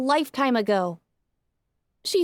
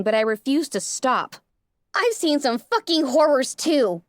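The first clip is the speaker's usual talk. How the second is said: ordinary delivery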